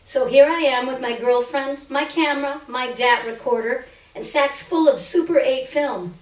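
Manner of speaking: ordinary delivery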